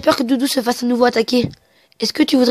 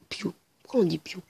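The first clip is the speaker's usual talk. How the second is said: soft breathy whisper aside; hushed curious whisper; 3 semitones lower, 13 dB quieter than usual. Real, not acted. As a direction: whisper it to yourself, puzzled. Aside wondering about the cry.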